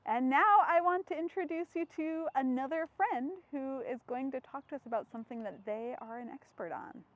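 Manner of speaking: ordinary delivery, nothing marked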